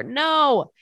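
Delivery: a high, loud gasp of a no; shocked no. Real, not acted. Reacting to the Instagram follow, loud delighted shock. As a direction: jump on it like juicy gossip. Hearing the staff member followed her.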